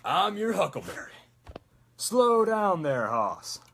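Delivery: high, drawn-out Texas drawl; loud, lazy Texas twang; McCree. Acted. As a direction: stretch it like a Texas cowboy. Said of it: "the Texas accent is so much fun".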